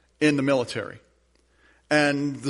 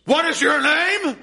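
high, slow, furious scream; screaming in fury; 14 semitones higher, 7 dB louder, slower than usual. Retold or imitated. The enraged king screams at the young soldier. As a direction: roar the question at him. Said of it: "And he screams".

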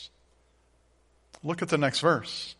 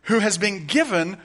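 higher, louder rising preacher's cadence; preaching at full force; 5 semitones higher, 6 dB louder than usual. Acted. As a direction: build to a fervent preaching climax. Preaching climax on the cross.

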